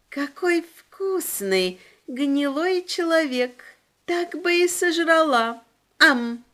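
put-on high, slow, gloating little demon girl; creepy demon girl. A narrator's reading. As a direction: savor the words like a hungry child demon. Demon girl relishes a human.

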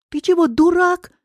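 high-pitched outraged question; shrill-edged, affronted outburst; indignant. A narrator's reading. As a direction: scold in startled indignation. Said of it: "возмутилась она".

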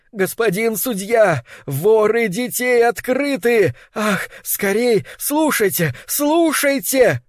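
high, slow, urgent calling; shrill shouting. A narrator's reading. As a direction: cry out for help, desperate. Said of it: "выкрикивая свистящим голосом".

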